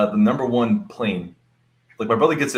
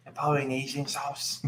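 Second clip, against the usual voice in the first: imitated Polynesian accent; 11 dB quieter, slower than usual. Mimicking friend's way of saying it.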